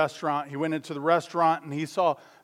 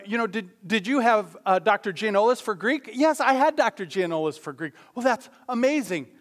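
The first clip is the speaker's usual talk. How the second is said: excited chatter; 7 semitones higher, 6 dB louder than usual. Retold or imitated. Excited men in joke.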